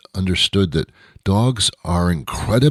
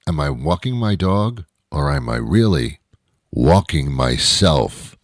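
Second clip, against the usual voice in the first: normal talking voice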